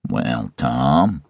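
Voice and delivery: low, soft, glum greeting; gloomy. A narrator's reading. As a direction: greet him glumly and quietly. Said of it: "he said gloomily".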